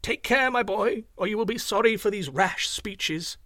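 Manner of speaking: high-pitched, earnest admonition; warning teacher. A narrator's reading. Teacher cautions his rash pupil, earnest warning. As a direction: warn the boy firmly. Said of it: "The good man would shake his hand".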